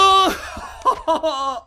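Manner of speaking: loud, high laugh after a scream; startled laugh. Real, not acted. Player getting over a jump scare, loud startled laughter.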